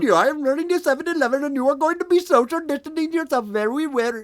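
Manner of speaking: high, loud mock Indian accent; hammed-up, insistent Indian accent. Store manager lays down distancing rules, Indian accent. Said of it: "any accents, unless Indian".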